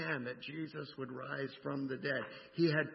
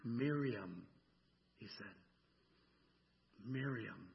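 1 tender name; 4 semitones lower, 4 dB quieter, slower than usual. Acted. Jesus calls her by name.

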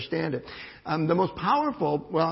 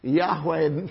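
ordinary delivery

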